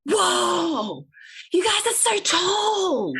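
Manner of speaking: high, drawn-out amazed voice; the barista marvelling at her height, high amazed voice; imitating the barista. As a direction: mimic a gobsmacked stranger. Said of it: "he goes".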